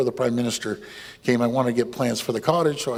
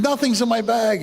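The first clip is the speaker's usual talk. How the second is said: higher, whiny denial from the thief; thief's denial; 8 semitones higher than usual. Retold or imitated. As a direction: play the caught thief protesting.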